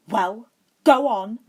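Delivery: loud, slow angry push; furious. A narrator's reading. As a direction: drive him away in fury. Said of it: "Harry said furiously".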